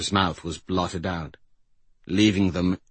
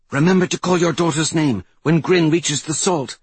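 ordinary delivery, nothing marked

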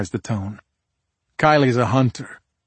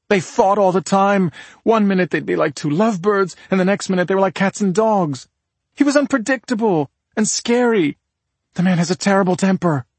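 high, heated voice of a worried sister; agitated woman's character voice, raised high; indignant sister; 9 semitones higher, 4 dB louder than usual. Acted. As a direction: press the case, pitched well up. Voices sister describing the couple.

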